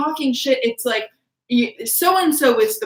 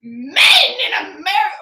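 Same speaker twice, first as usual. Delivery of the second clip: put-on Australian accent; 5 semitones higher, 5 dB louder, slower than usual. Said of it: "I was going to try right there".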